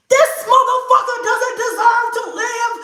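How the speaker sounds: high, loud mock-furious rant; retold rage. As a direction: rant about him, hammed up for the story. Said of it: "I was so mad".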